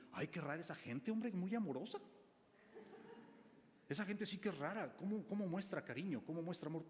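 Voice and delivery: mock bewildered newcomer at church; puzzled churchgoer. Preacher imitating a churchgoer baffled by loving brothers, lightly mocking.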